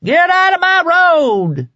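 long, high, loud roar. A narrator's reading. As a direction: bellow it slowly at full force. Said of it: "the kid roared".

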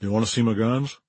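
lightly voiced Southern star; understated impersonation with a Mississippi lilt. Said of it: "Then he said".

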